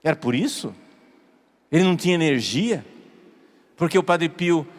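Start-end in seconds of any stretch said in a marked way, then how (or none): none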